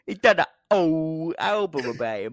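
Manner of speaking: hammed-up East London chat; East London accent. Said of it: "almost went really East London".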